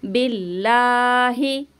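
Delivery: बिल्लाही with a light, thin laam, drawn out slowly; thin Allah. Shown as a demonstration. Teacher reciting बिल्लाही thinly for her students. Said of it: "बारीक पढ़ना है इसको".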